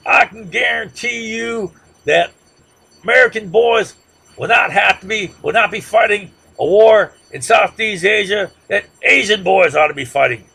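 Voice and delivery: high, loud, slow Texas drawl impression of a campaigning president; Johnson's Texas drawl. Lecturer mocking Johnson's peace-ticket speech in a loud Texas drawl. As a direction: ham up a Texas politician's drawl, promising loudly. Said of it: "in his Texas drawl".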